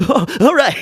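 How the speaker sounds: high, over-the-top giggling; Fallon laugh. Shown as a demonstration. Podcaster shows Fallon's excessive laughter, high and loud. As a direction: cackle like a giddy host. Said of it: "Just over excessive laughter".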